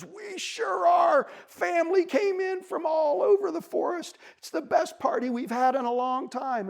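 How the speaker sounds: high, boasting beaver voice; jolly beaver. A narrator's reading. Beaver tells bunny about family party, jolly. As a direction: call it out proudly, easing down.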